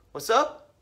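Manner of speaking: mock-nonchalant, unbothered greeting; cool guy. Narrator answering the furious driver, coolly casual. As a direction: toss it off like an unflappable cool guy. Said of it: "the coolest guy ever".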